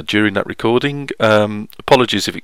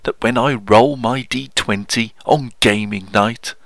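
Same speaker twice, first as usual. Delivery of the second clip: posh poetry voice; 3 semitones higher than usual. Acted. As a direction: declaim comic verse in a put-on posh voice. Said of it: "It's my poetry voice".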